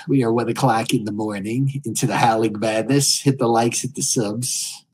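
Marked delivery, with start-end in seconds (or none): none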